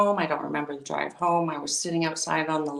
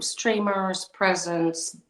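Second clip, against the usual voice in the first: normal talking voice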